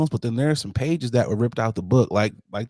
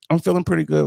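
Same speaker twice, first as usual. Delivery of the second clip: ordinary talk, nothing marked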